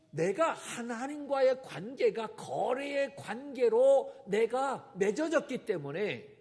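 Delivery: normal talking voice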